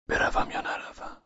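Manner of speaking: hushed, wavering-in-thought question; asking himself. A narrator's reading. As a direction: murmur it privately, very soft. (آهسته، از خود پرسیدن)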